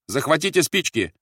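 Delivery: high, loud shouted request; calling out. A narrator's reading. Officer calls to his aide for matches, calling out. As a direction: call an order after her. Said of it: "крикнул Рольф".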